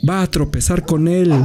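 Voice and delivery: high-pitched howl of warning; howled alarm. A narrator's reading. Dogs fear their master will meet Death, high howl. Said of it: "aullaron todos".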